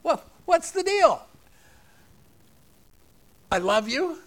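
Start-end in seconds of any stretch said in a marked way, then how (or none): 0.0-1.3 much higher and louder, exasperated; a big incredulous cry of what's the deal; indignant high shout. Shown as a demonstration. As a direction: throw it up high like a fed-up parent. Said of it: "repeated louder, higher".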